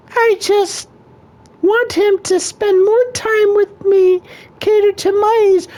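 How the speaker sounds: whiny high-pitched wife voice; a high squeaky woman complaining to a counselor; girlfriend voice. Acted. Counselor asks couple, wife answers.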